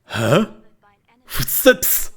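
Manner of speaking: mock lisp on Footsteps; lisping guard. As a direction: play the guard with a heavy lisp. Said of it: "the dude is seeing your footsteps and goes".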